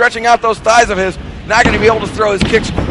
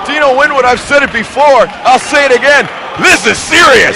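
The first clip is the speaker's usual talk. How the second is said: loud, high excited call; excited commentator; 8 semitones higher, 7 dB louder than usual. Real, not acted. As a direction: sell the big moment. Commentator reacts to knockdown.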